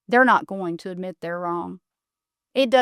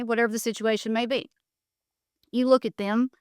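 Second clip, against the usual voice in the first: ordinary delivery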